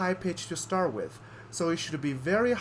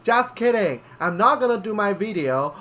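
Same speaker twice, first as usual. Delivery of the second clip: louder aside; 5 semitones higher, 6 dB louder than usual. Acted. Breaking the ASMR joke.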